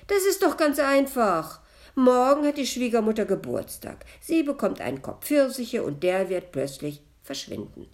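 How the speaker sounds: loud, high, animated ghost; eager explaining. A narrator's reading. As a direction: explain the scheme with loud impatience. (laut und eifrig erklärend)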